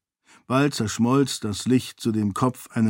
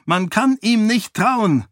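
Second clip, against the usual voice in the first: high, loud desperate cry to her father; screaming; 10 semitones higher, 4 dB louder than usual. A narrator's reading. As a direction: cry out to warn him. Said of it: "schrie Sarene". (verzweifelter Schrei)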